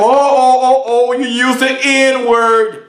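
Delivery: mocking a listener offended by the word; mock shocked objector. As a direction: clutch your pearls, drawn out. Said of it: "you may say to yourself".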